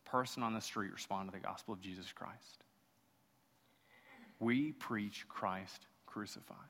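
usual speaking voice

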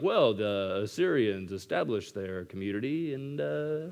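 mock a know-nothing trying to sound smart; lightly voiced bluffing know-it-all; clueless Steve voice. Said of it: "why Steve's voice does that".

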